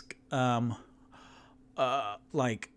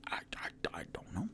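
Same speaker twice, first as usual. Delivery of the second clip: whisper; 10 dB quieter than usual. Acted. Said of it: "And Tutu's like".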